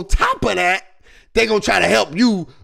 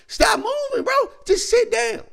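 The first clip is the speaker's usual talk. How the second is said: much higher, pleading yelp; exasperated point guard. Pretend. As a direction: beg him to stop running, voice cracking up.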